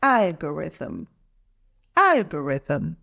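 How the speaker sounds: "Algorithm" with the opening syllable stressed; stress on first syllable. Shown as a demonstration. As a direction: model "Algorithm" clearly, hitting the first syllable. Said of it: "stress is on the first syllable".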